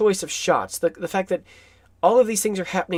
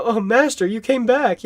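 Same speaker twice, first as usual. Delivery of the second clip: lightly acted plea to a master, higher-pitched; Renfield; 5 semitones higher, 3 dB louder than usual. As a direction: imitate an abandoned servant's relief, raised pitch. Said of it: "he felt abandoned".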